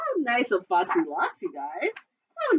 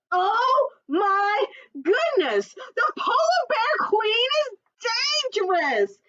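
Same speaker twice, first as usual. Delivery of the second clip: very high, loud, slow exclamation; alarmed cry; 5 dB louder, slower than usual. Acted. Puffin telling friends the queen is dangerous, shrieking. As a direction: shriek it in shock. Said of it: "said Puffin Muffin".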